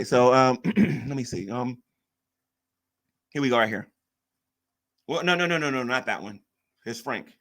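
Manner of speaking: ordinary delivery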